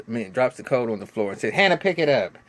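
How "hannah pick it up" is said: bossy order. Retold or imitated. Voicing a bossy mother-in-law.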